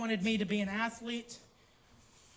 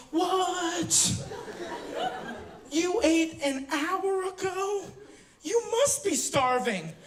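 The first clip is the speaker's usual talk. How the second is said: higher, slow, laughing disbelief; mock shocked mom; 6 semitones higher, much slower than usual. Friend's mom hearing he ate recently, mock shocked. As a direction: act amazed someone ate an hour ago. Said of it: "And she goes".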